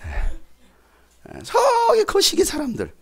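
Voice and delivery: slow, hammed-up provincial drawl with a squeaky high end; playing a country bumpkin, lightly mocking; mock country dialect. Preacher mocks Galilean speech, hammed-up country accent. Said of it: "지방 사람".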